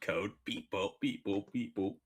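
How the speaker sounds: lightly voiced beep-boop robot, mocking; a hint of a chirpy machine. Mocking the chat bots who drop in, lightly robotic.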